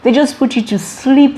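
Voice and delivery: ordinary delivery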